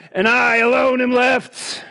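slow, loud self-pitying lament; Elijah's wail. Acted. Quoting Elijah's complaint.

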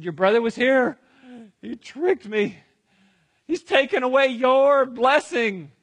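loud, high, upset father; distressed outcry. Acted. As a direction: break the terrible news loudly. Distraught Isaac tells of the trick.

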